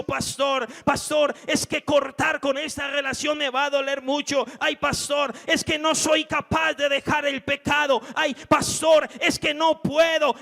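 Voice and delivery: high, loud lamenting "ay, pastor"; whining congregant. Acted. Church member lamenting to the pastor, loud mock whine. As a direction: moan and beg like you can't bear it.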